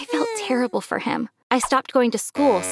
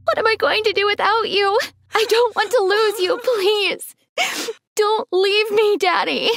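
much higher, slowing on the last plea; desperate crying plea; 11 semitones higher than usual. Acted. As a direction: beg him not to leave, voice near breaking. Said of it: "I cried desperately".

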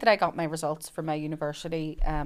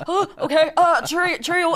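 flustered goodbye; 10 semitones higher, 6 dB louder than usual. Retold or imitated. Gerry hurrying away, retold.

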